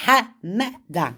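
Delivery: Hamada drawn out slowly, the H from the throat; slow careful Hamada. Shown as a demonstration. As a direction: slowly sound out Hamada with the Arabic H. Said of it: "dis Amada avec l'accent".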